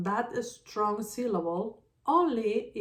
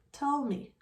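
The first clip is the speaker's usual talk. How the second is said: ordinary delivery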